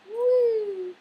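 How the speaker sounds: high, loud woo breaking into a laugh; giddy woo and laugh. Real, not acted. Vlogger jokes her pill wore off, giddy laugh. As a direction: burst out giddy. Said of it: "My pill wore off".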